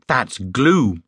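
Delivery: GLUE as a falling statement; matter-of-fact explanation. A narrator's reading. Bear correcting Mister Brown about the stain, explaining. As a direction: state GLUE simply, with the pitch dropping. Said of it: "explained Paddington".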